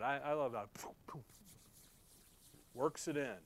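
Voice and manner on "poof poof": two soft airy spitting puffs; imitated spitting. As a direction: mime spitting with little puffs of air. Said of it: "he spits on it".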